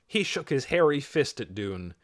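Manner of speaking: plain reading voice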